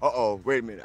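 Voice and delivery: ordinary talk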